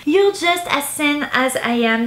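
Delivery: English title in a heavy French accent; thick French accent. Real, not acted. A French booktuber reads an English subtitle in a thick French accent. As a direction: read the English words with a strong French accent. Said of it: "avec mon accent à couper au couteau".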